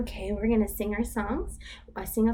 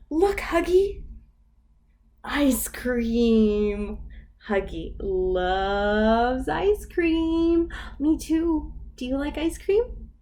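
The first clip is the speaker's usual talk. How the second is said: storybook voice, lively for little ones; reading to children; 5 semitones higher, 3 dB louder, slower than usual. Acted. Teacher reads the picture book to toddlers. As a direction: read it like circle time.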